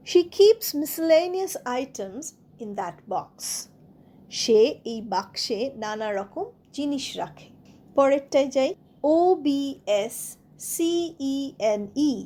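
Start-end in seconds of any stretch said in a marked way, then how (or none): none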